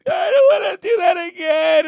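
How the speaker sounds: loud, high-pitched mock wail; crying kid. Kids coming off the ride, mock crying loudly. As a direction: bawl like a little kid, hammed up. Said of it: "Some kids were crying".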